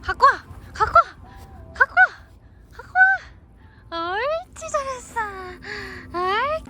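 high excited fetch commands; talking to a dog. Real, not acted. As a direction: cheer the dog on loudly. (강아지한테 신나서 부르는 말투)

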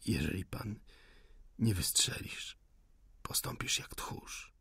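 near-whispered challenge; faint defiant taunt. A narrator's reading. Wounded insurgent calls the officer coward, whispering. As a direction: taunt softly, breath almost spent.

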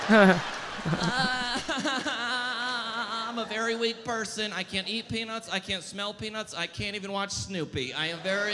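mock a fragile modern child bragging about allergies; whiny kid. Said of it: "why the bragging?".

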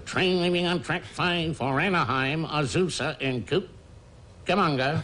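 high announcer call, stretched out slowly; train caller. Retold or imitated. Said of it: "a train caller at the depot who'd say".

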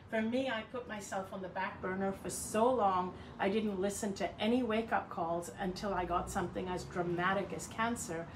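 ordinary delivery

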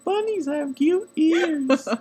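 very high, slow cutesy voice; cute squeaky voice. Acted. Hanging upside down, cooing to the camera in a high, slow cutesy voice. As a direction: coo it like a baby talking about bunnies.

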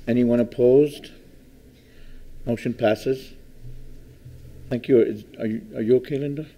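ordinary delivery

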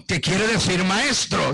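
ordinary talk, nothing marked

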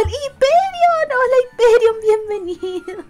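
high, loud, drawn-out name call; light up as the viewer arrives; squealed welcome; excited greeting. Real, not acted.

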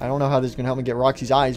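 normal talking voice

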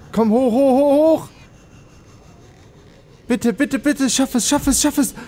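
will the machine to make it; urgent pleading. Real, not acted. Gamer begging his sub to climb, high urgent pleading. Urging stuck sub upward.

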